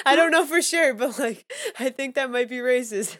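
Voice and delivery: playful mock accusation, high and loud; joking accusation. Real, not acted. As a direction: tease her with mock outrage.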